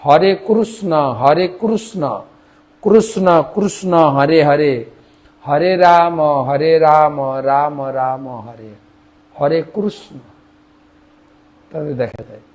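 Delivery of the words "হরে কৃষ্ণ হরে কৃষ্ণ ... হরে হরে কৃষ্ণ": Odia "Kurusno", with a u in কৃষ্ণ; slow Odia chant, "hore kurusno"; Odia accent. Retold or imitated. Teacher imitates people of Odisha chanting, Odia accent. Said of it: "তারা আবার কৃষ্ণ বলে না".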